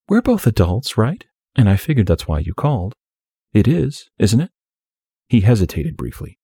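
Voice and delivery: lightly voiced, coy young woman.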